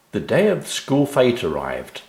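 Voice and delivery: ordinary delivery, nothing marked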